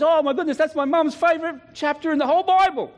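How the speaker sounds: high, amazed daughter voice. Retold or imitated. Daughter amazed at her mum's favourite psalm, high and amazed. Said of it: "the daughter goes".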